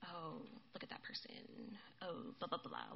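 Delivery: low, soft muttered griping; bitter grumbling. Acted. Passive-aggressive person sniping at someone, bitter grumbling. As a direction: grumble bitterly under your breath. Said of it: "like a bitter person".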